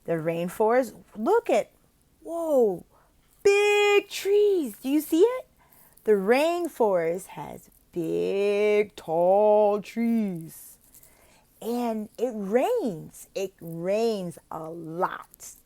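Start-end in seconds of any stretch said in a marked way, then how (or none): 1.2-5.5 high, loud gasp of wonder at big trees; excited wonder. Shown as a demonstration. Tutor shows the child big trees with excited wonder. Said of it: "Very simple". 6.0-15.5 very slow, clear teacher talk; slow simple explanation. Shown as a demonstration. Tutor explains the rainforest very slowly. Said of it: "Very simple".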